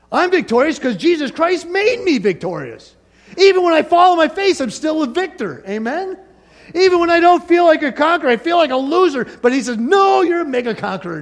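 high, loud, fired-up proclamation; rousing declaration of victory, pitched high; triumphant preaching. Real, not acted. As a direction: build to full-voiced pulpit fervor.

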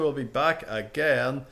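usual speaking voice

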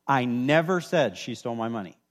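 "never" punched out, the rest falling away; stress on NEVER. Shown as a demonstration. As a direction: lean hard on the word "never". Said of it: "emphasize a different word every time".

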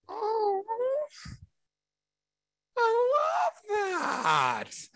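very high, drawn-out mock squeal; swooning bride impression. Bride hearing of temple sealing for eternity, mock swooning. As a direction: gush in an exaggerated falsetto, stretching it out.

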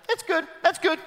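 a hint of a cheerful courtier; cheery advisor. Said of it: "turns around and goes".

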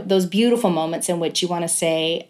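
ordinary delivery

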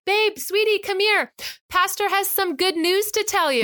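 high, sugary-mocking call to a child; yelled call. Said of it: "I yell her name and I'm like".

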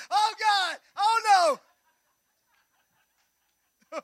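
high, loud mock wail of dismay; comic shout of despair; mock panic. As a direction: ham up the family's horror. Family panics as power flickers.

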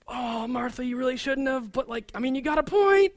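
high, falsetto-ish gossiping bystander; onlooker voice. Retold or imitated. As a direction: play an embarrassed onlooker agreeing. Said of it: "And they're like".

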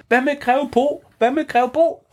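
high worried question; lightly worried kids. Acted. Kaj and Bamse asking about Grev Bo, high worried. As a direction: ask anxiously about the friend. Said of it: "så siger de".

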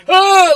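loud, high mock-panicked "oh"; alarmed cry. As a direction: yelp in retold alarm. Retold alarm as cars rush past.

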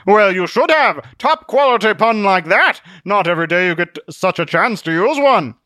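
high, loud, grand delivery; blustering Zealot, pleased. Acted. Zealot defends his pun to Truth, pleased bluster. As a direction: declare it with self-satisfied gusto.

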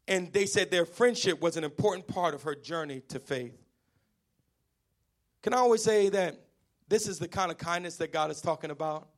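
normal talking voice

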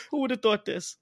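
ordinary talk, nothing marked

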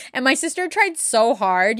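ordinary delivery

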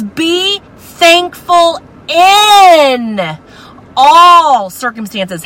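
"in" drawn out very slowly and shouted high; stress on in. Shown as a demonstration. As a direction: hold and shout "in". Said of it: "this preposition is so important".

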